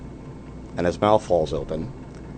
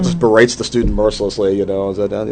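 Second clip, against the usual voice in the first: ordinary talk, nothing marked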